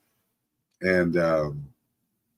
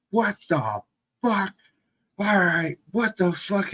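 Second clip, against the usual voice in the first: imitated parental shock; 10 semitones higher than usual. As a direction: act out appalled parents seeing the damage. Parents discovering road rash, retold.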